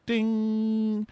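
high, ringing "ding"; imitated triangle ding. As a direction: chime like a triangle. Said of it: "every time I hear a ding".

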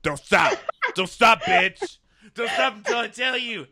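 high, loud pushy guy demanding a red light; mock bachelor yelling don't stop. Impersonating guy refusing to stop.

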